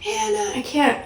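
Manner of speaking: thin, high, soft pleading call; calling for help. Retold or imitated. Stuck in sleep paralysis, calling to her partner and niece for help, thin and high. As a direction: call out weakly, high and frightened. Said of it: "screaming for Martin and Hannah".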